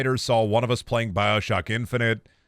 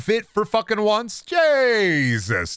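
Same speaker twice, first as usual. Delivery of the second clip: exasperated; 10 semitones higher, 4 dB louder, much slower than usual. Real, not acted. Exasperated outburst.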